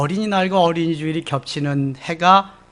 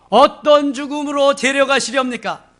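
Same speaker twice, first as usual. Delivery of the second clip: higher, louder nosy question; louder nagging question; pushy question; 9 semitones higher, 7 dB louder than usual. Acted. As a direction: press the Lord for an answer. Peter questioning Jesus.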